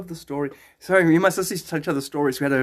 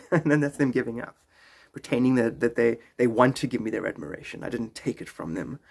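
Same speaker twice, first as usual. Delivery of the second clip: ordinary delivery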